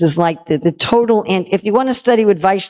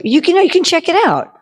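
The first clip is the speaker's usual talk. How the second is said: much higher, eager persuading; coaxing con man; 10 semitones higher than usual. Acted. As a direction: egg him on innocently to touch his head. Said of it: "the ultimate con man".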